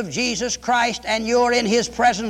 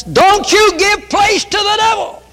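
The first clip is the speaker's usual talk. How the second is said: high, loud warning cry; a preacher's loud, high alarm; preaching shout; 11 semitones higher, 7 dB louder than usual. Real, not acted. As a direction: hurl the warning at the crowd. Preacher warns against the devil.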